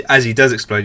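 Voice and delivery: usual speaking voice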